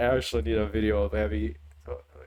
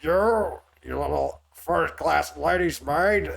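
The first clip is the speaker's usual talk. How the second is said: Heavy; 3 semitones higher, slower than usual. Acted. Reader playing an accuser in Heavy's voice, R-intensive accent. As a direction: attempt an outraged accent, leaning hard on every R. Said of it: "Yes, Heavy".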